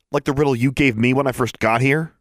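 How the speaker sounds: put-on indignant young challenger; accusing girl. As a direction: throw it back at the beast. Girl argues with sphinx.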